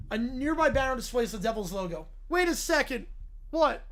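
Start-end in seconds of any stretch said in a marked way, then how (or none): none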